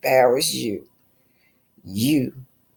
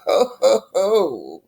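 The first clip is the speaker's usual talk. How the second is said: mocking laugh; 4 semitones higher, slower than usual.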